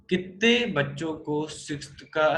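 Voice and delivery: ordinary delivery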